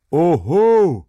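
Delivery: loud, high mocking cry; a dozen throats bellowing at once; crowd's outcry. A narrator's reading. Workers roar back at the dike reeve, a loud high outcry. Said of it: "erscholl es".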